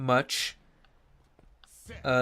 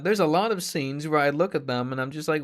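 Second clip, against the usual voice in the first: usual speaking voice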